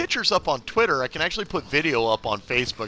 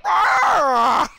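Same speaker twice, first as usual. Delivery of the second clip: loud burst of laughter; 6 dB louder than usual. Real, not acted. Loud laugh after getting punched.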